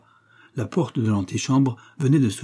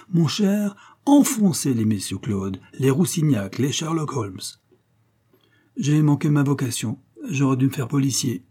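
boastful, slightly higher-pitched boasting; self-satisfied amateur detective; 5 semitones higher than usual. Acted. Coquille boasts to his friend about his flair, self-satisfied. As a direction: brag grandly as a would-be detective.

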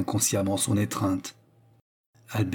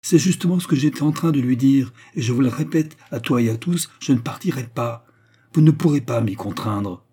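defiant; 4 semitones higher, 6 dB louder than usual. Acted. Albert's defiant tirade.

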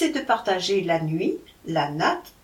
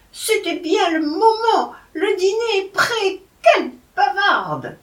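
put-on higher, louder scolding of a late girl; scolding mother; 12 semitones higher, 6 dB louder than usual. A narrator's reading. Madame Tsin scolding Ning for coming late, higher and louder. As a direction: nag her sharply for chattering.

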